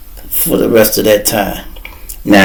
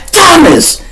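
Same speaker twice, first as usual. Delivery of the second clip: calling out; 3 dB louder than usual. Retold or imitated. Lawyer calls cop's name.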